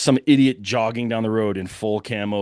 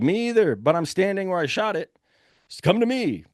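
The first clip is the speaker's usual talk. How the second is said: high, carrying call through the woods; mild mock yell across the trees; retold yelling; 9 semitones higher than usual. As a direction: holler directions to a partner far away. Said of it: "we're just screaming in the woods".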